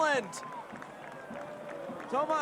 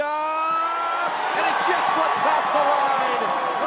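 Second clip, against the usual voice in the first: drawn-out shout; 2 semitones higher, 8 dB louder, much slower than usual. Real, not acted. Near-miss call on the goal line.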